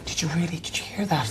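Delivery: hushed, unvoiced whisper; very soft secretive question; whispered prank question. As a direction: whisper it as if you overheard something. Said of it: "I'd be like".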